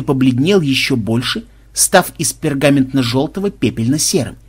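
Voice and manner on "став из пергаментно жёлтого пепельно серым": ordinary delivery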